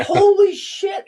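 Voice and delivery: high, drawn-out astonished exclamation; stunned amazement. Retold or imitated. Melissa reacting to seeing him on Instagram, stunned. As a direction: stretch it out in soaring disbelief.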